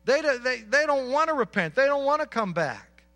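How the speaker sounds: preacher building to a loud peak; fiery preaching. Acted. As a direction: press the warning home with full force. Preaching at full force.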